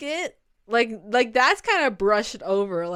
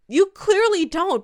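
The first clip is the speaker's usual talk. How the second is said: usual speaking voice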